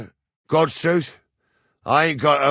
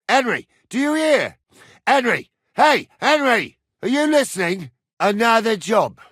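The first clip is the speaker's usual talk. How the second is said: high, loud attention-getting shout; insistent calling; 9 semitones higher, 4 dB louder than usual. Acted. Man with another job for an unlistening Henry, calling insistently. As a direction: shout to get his attention.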